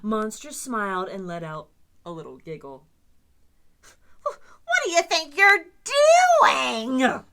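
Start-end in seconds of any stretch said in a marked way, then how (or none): 4.2-7.2 high, loud outraged cry; cried out. Acted. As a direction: scold the monster in disbelief. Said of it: "cried Grouch".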